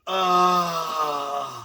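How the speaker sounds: long, loud "ahh" of relief; relieved groan. Retold or imitated. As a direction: let out a drawn-out sigh of bliss. Acts man relieving himself.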